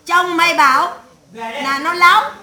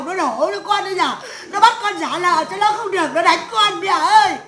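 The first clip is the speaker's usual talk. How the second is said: imitated wailing complaint; 2 semitones higher than usual. Imitating a wife phoning her mother about a beating, wailing. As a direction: mimic a wronged wife crying out for help. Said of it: "Vu loa đau lạ lên".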